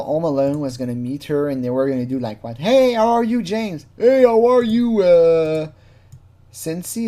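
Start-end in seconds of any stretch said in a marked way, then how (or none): 2.6-7.1 high, loud mock-eager hello; mock greeting. Imagined greeting between James and the girl, loud hammed-up hello. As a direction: overplay an enthusiastic doorstep greeting.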